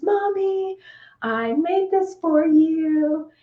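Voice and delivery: put-on high, slow childlike voice offering a gift; a small girl holding out her egg; little daughter's voice. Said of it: "And she says".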